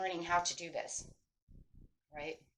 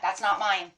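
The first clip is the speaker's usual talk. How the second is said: "that's not mine" said louder, with weight; big emphasis; 5 dB louder than usual. Shown as a demonstration. Teacher showing how to block others' moods, strongly emphatic. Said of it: "put a real big emphasis on that".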